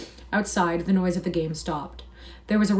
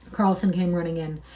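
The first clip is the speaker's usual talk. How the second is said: plain reading voice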